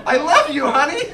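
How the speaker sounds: loud, high gushing love; elated declaration. Acted. Husband telling his wife he loves her, loudly elated. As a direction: proclaim it joyfully.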